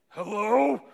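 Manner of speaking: called out in the deepest voice to scare a burglar. Retold or imitated. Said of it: "in the deepest voice I could go".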